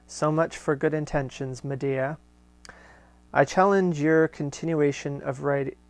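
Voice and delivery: steady, fuller read-aloud voice; letter reading. Acted. Host reading Corinne's letter aloud to listeners, measured reading voice. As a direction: read the old letter out with care.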